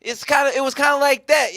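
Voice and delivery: ordinary talk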